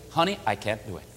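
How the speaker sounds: soft, low reported plea; understated, quiet no to a husband; gentle refusal. Retold or imitated. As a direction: voice the wife's refusal, hushed and low. Wife gently refuses her husband.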